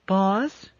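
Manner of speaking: put-on small, high, hesitant girl's voice; a shy child peeping up from the dark; timid little girl. A narrator's reading. Ship computer calling her captain in a timid little girl voice. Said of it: "It was the voice of a timid little girl".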